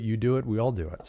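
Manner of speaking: ordinary talk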